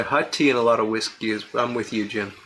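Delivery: normal talking voice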